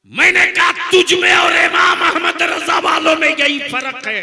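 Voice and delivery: shouted high fiery sermon; full-force preaching. Real, not acted. Preacher shouting the punchline at the crowd. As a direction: preach it at the top of your voice.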